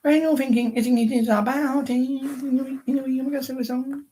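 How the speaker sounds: squeaky high put-on voice, rambling; high silly voice. To the viewers, rambling in a high silly voice. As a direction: pitch it way up like a silly cartoon.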